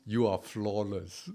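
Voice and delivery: slow, soft "you are flawless" reading out the result; mock solemn verdict. Pastor reveals his quiz result to the congregation in a slow, hushed voice. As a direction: read the verdict low and slow, holding back a laugh. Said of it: "it turned out".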